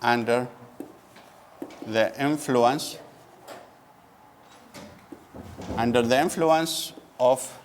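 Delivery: slow, word-by-word dictation pace. Shown as a demonstration. Teacher dictating a definition to the class, slowly. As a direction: spell it out slowly so students can write. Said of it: "So I write it here".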